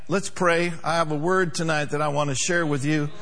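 ordinary talk, nothing marked

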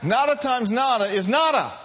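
loud, high preacher's exclamation; emphatic shout. Real, not acted. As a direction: belt it out as a triumphant punchline. Repeats the saying emphatically after laughing.

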